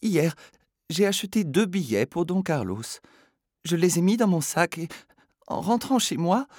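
high, agitated lady's voice; flustered lady. Acted. Lady in a flutter, explaining high. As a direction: tell Philippe of the tickets, all in a flutter. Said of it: "disait une dame en émoi".

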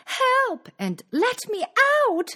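high-pitched cry for help; bellow. A narrator's reading. Locked-up Cinderella calls for help, high bellow. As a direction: bellow it out high, pleading to be freed. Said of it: "She bellowed".

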